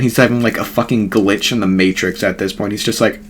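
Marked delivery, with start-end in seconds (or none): none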